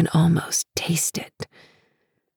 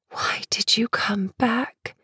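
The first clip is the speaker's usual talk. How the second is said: whispering; 6 semitones higher, 5 dB quieter than usual. Acted. Whispered question to lover.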